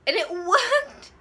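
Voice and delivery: loud, high and slow, laughing through it; a laughing cry of delight. Real, not acted. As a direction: crack up in disbelief. Delighted disbelief at the scene.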